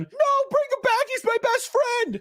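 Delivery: put-on very high, slow pleading little boy; whiny child. Boy begging parents to return his doll, very high whine. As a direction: beg like a small kid. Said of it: "what he's probably doing".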